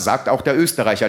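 ordinary talk

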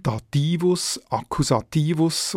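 Dativus, Akkusativus stressed on -TI-; accent the penultimate syllable in Dativus and Akkusativus; Latin stress. Shown as a demonstration. Editor citing older Latin forms, Dativus and Akkusativus stressed on -ti-. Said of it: "noch in der latinischen Form".

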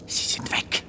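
hushed whisper, stress on weg; relieved whisper while in hiding. Acted. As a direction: breathe it so no one hears. Hiding, checking the searchers left.

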